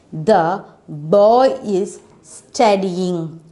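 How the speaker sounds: slow, clearly spaced sentence; slow model reading. Shown as a demonstration. Teacher reading the sentence slowly to the children. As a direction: read it slowly, word by word. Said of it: "teacher models sentence for children".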